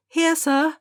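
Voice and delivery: high, sing-song schoolchild reply; high childish call of here sir; children calling out. A narrator's reading. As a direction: answer the register like a pupil. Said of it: "to call out".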